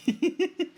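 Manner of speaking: high-pitched giggle; short, high burst of giggles; laughing. Real, not acted. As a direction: crack up after the act. Cracks up after zebra act.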